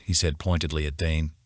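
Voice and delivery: plain reading voice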